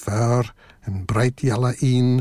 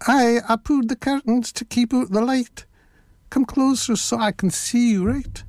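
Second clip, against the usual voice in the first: high put-on granny voice; wolf posing as a granny; fake old lady; 9 semitones higher than usual. A narrator's reading. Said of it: "his best old lady's voice".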